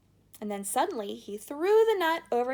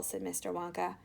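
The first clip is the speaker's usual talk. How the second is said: ordinary delivery, nothing marked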